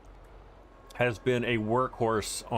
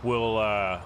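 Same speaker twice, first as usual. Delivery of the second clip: ordinary talk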